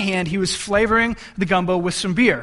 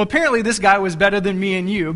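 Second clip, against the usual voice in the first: usual speaking voice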